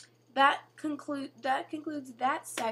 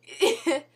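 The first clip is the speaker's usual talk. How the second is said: loud falling laugh; real laugh between words; short laugh; 7 semitones higher, 8 dB louder than usual. Real, not acted. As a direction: let a quick laugh out. Laughing again.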